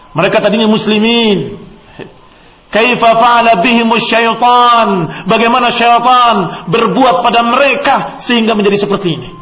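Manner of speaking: high, loud and slow, the preacher's full-force lament; fiery preaching. Acted. Preacher to his audience, lamenting loudly how Satan misled them. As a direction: cry out the question to the congregation, slowly.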